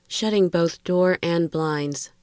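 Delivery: usual speaking voice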